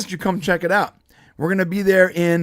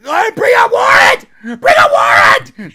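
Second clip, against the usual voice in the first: shrieking, much higher and louder; mock screaming woman freaking out; hysterical shout; 10 dB louder than usual. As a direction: scream it in a panic. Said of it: "And she's like".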